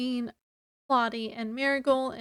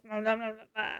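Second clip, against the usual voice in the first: low, soft mock grumble under the breath; rude grumbling; 3 semitones lower, 6 dB quieter, faster than usual. Grumbling rudely in the background while the potion is given. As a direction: mumble it rudely, like a sulking sister. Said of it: "being rude and grumbling in the background".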